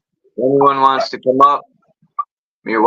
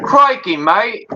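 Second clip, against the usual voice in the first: much higher-pitched mock Australian accent; imitated Australian accent; 9 semitones higher, slower than usual. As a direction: put on the Aussie accent, pitched way up. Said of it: "when you say it with the accent".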